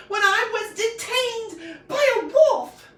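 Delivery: high, slow, loud indignant yell; yelling girl. A narrator's reading. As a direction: shout it out, offended. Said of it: "yelled the girl".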